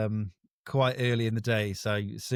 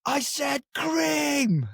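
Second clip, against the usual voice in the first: high, slow, stretched mock yell; shrill-free mock rage at the counter; angry bloke shouting; much slower than usual. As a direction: ham up the furious customer. Angry customer shouting retold.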